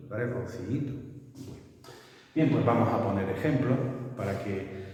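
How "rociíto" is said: Rociíto with a slight break between the two i's, ro-ci-í-to; Rociíto said softly with a break between the twin vowels; careful hiatus. Shown as a demonstration. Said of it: "tenemos que hacer una pequeña pausa".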